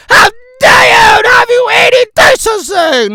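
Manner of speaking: hammy scandalized shout; mock indignation. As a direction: play the scandalized lady.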